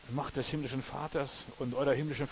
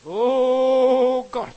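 a much higher, much louder cry of pain to God; anguished cry; 12 semitones higher, 15 dB louder than usual. Shown as a demonstration. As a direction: let it burst out like real pain. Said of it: "Du schreist nur, wenn es dir wirklich wehtut".

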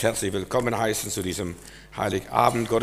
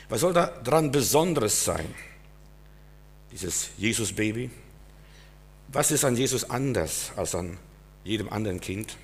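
normal talking voice

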